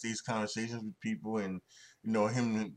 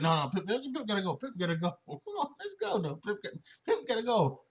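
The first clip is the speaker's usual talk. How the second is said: high, rapid mock insistence, laughing through it; retold insistent pleading; 12 semitones higher, faster than usual. Jordan begging for Pippen to come golfing, imitated high insistent pleading. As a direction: imitate pestering buddies, voice pitched way up.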